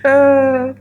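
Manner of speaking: a high, choked laugh through tears; teary laugh. Real, not acted. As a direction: laugh while fighting back tears. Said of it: "why am I so emotional?".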